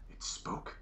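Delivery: hushed, whispered question; voiceless, incredulous whisper; whispered disbelief. Acted. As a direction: whisper it as if stunned. Inquisitor stunned the corpse spoke.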